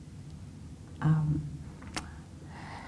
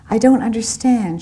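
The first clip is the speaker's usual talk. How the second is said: "I don't understand" as a mild lifted moan; 5 semitones higher, 5 dB louder than usual. Acted. Rosa in the cafe, moaning to Antonio, mildly voiced. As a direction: let "I don't understand" come out as a half-hearted moan. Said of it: "she moaned".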